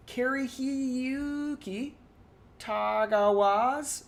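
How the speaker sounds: slow, halting read of the name Karihiyuki Tagawa's; stumbling pronunciation. Real, not acted. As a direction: sound out "Karihiyuki Tagawa's" unsure and drawn out. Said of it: "sorry, I apologize for butchering that".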